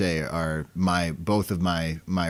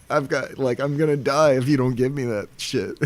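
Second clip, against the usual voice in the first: hammed-up pathetic begging, pitched up; higher-pitched imitation of a pitiful patient; mock pathetic plea; 7 semitones higher, 3 dB louder than usual. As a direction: plead pathetically, as if milking it. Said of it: "pathetic sounding".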